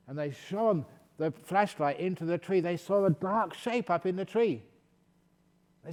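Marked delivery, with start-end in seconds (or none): none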